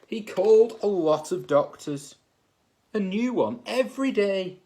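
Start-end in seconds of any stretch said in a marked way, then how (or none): none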